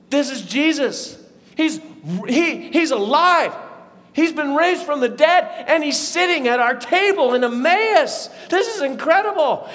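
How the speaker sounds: loud, high astonishment; amazed realization. Acted. Disciples recognise the risen Jesus.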